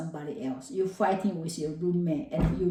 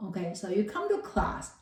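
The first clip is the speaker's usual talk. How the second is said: usual speaking voice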